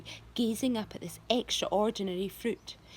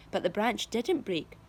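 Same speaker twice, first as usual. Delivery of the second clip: plain reading voice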